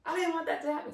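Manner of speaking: high, loud, racing excuse; animated imitation, breathless insistence; extremely animated. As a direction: rattle it off like a spooked boy defending himself. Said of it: "like, extremely animated".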